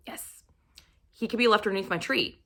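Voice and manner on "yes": a swooning whispered yes; breathy whisper. Real, not acted. To viewers about Jamie, a swooning whispered yes. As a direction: sigh it out under the breath.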